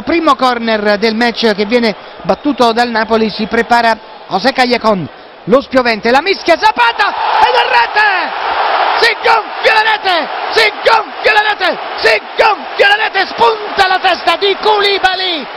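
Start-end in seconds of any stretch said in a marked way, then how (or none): 6.1-15.5 breathless italian goal commentary. Real, not acted. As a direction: shout the goal with everything. Goal call, repeated shout.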